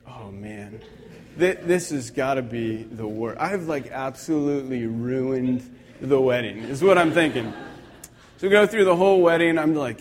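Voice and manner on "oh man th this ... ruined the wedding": low, subdued inner groan of defeat; retold dismay. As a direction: sink into a quiet, dismayed aside. Retold dismayed thought at wedding.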